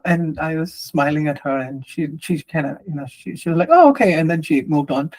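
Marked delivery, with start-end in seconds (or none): 3.7-4.1 lightly voiced surprised clerk, higher and louder; a bright startled okay from the clerk; mock surprised oh. Clerk's reply voiced in story.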